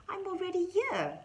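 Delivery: very high little mouse squeak. A narrator's reading. Mouse tells Rabbit he is indoors, squeaking.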